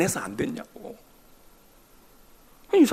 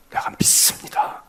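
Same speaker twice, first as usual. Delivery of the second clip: breathy whisper. Acted. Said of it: "소리 질렀다는 거야".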